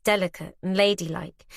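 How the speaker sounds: ordinary delivery, nothing marked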